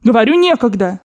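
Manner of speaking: exasperated shouting. A narrator's reading. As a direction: brush them off sharply. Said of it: "закричала бабушка".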